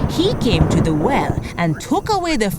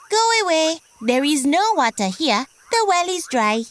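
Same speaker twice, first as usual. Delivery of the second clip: higher, haughty frog voice; a proud little frog shooing him off; 9 semitones higher than usual. Acted. Proud frog turning the thirsty buffalo away, higher voice.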